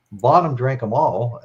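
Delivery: ordinary talk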